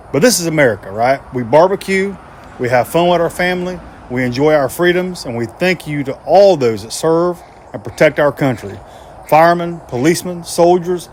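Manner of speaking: proud, loud salute to the troops; patriotic address. Acted. To the troops, a proud patriotic tribute. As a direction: speak it like a tribute to those who serve.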